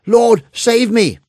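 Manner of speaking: high, loud, slow cry for help; desperate cry. A narrator's reading. Peter sinking in the lake begging Jesus, desperate loud cry. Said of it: "He cried out".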